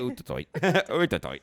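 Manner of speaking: high, laughing mock Irish; Irish accent attempt. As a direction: try the Irish again. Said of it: "Irish accent".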